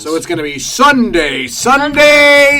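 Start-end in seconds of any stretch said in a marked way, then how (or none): 1.5-2.6 higher, louder hyped-up call; hype ad shout. Acted. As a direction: belt it like an announcer.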